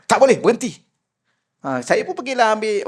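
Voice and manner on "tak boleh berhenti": angry sheikh. Retold or imitated. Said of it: "Syekh marah sungguh".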